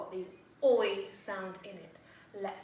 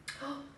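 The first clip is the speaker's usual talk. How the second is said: gasp; 6 semitones higher, 6 dB quieter than usual. Pretend. Surprise at new sound.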